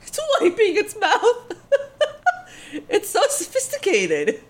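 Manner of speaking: high-pitched, giggling through the words; laughing while talking. Real, not acted. Viewer cracking up at the monster's table manners, laughing high. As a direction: barely get it out through laughter.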